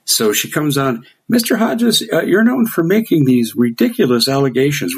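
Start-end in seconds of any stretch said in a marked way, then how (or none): 1.3-5.0 a hint of a young girl's higher voice; lightly mock the young host; young Russian host opens the interview, lightly imitated; young interviewer. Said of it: "she sounds like she's fifteen".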